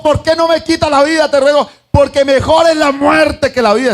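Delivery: a bitter prophet begging for death; preacher hamming up Jonah's fury; angry Jonah. Retold or imitated. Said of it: "Estaba enojado Jonás".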